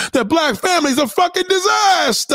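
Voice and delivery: high-pitched exasperated outcry; exasperated rant. Real, not acted. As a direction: cry it out in exasperation. Rant on angry families.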